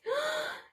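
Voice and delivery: very high sharp gasp; a sudden panicked breath; frightened gasp. A narrator's reading. Storyteller gasps as baboons surround the girl.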